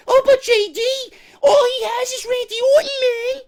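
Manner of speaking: very high, whining fanboy voice; mock whiny fan. Mocking a fan who defends Jinder, high whiny voice. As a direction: mimic a crying fan begging for sympathy.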